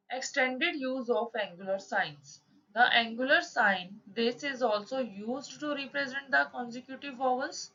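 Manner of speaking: reads the textbook rule out slowly, word by word; slow rule reading. Shown as a demonstration. Teacher reading a shorthand rule aloud to students, slow dictation. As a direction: dictate the rule at half speed.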